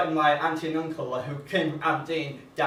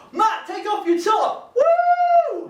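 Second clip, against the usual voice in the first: high, drawn-out heckling shout; rowdy lady heckler; 6 dB louder, much slower than usual. Retold or imitated. Older lady heckling Matt to take off his top, rowdy shout. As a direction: whoop it up as a rowdy imitated heckler. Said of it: "this, uh, an older lady, uh, uh, she was like".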